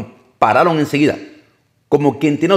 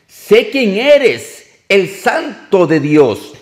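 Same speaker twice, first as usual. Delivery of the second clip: high, slow, pleading cry of a demon, lightly voiced; a possessed man crying out to Jesus, slowed and raised; 6 semitones higher, 3 dB louder, much slower than usual. A narrator's reading. Unclean spirit crying out at Jesus, high and slow. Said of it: "dio voces, gritó, diciendo".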